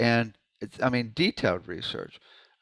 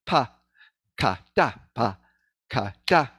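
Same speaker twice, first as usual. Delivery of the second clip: rhythmic drill with the accent landing on "da"; accented syllables. Shown as a demonstration. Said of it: "You can even do accents".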